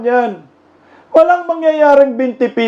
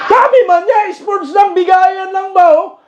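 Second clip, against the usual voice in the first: mocking quote; 6 semitones higher than usual. Mocking the challenger's words.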